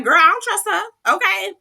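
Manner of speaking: high, loud, sassy retort; mock-sassy answer for Sutton. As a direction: snap back with full attitude. Answering the producer's question.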